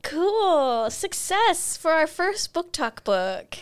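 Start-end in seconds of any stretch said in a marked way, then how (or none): none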